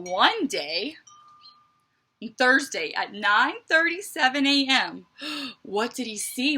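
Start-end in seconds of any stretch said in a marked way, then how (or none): 5.1-5.6 quiet dramatic gasp; soft dramatic gasp. Acted. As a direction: gasp softly to build suspense. Suspense before bee appears.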